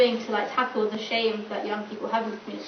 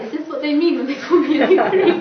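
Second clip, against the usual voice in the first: high, loud words breaking into laughter; incredulous laughter; 5 semitones higher, 10 dB louder than usual. Real, not acted. Student baffled by the Mipri video, laughing incredulously. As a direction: ask it through rising laughter.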